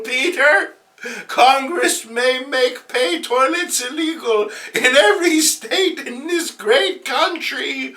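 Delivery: put-on high, quavering old auntie on TV; an elderly aunt making a fond appeal; Aunt May voice. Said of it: "doing that Aunt May voice".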